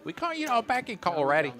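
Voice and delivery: easygoing southern talk, lightly done; southern accent. Acted. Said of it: "me doing the southern accent".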